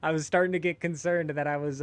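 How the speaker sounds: high laughing confession. Real, not acted. Confessing his caffeine worry to friends, laughing.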